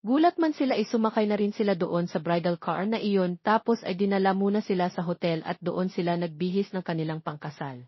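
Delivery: ordinary delivery, nothing marked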